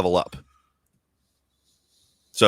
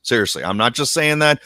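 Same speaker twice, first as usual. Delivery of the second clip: ordinary talk, nothing marked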